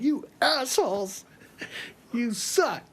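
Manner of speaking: exaggerated angry jab; mock insult. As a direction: lash out at the band, half joking. Yelling insults at bandmates.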